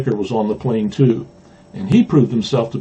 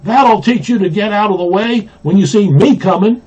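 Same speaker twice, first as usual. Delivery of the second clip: raised, higher-pitched storyteller's shout; 7 semitones higher, 4 dB louder than usual. A narrator's reading. Henry Blue Jay to the birds he knocked down, shouted. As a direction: call it out high, a scolding shout in a read-aloud. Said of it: "He turned and shouted".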